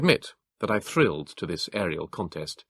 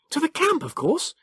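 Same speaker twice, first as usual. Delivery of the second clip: Hilary's voice. A narrator's reading. Hilary answers curtly.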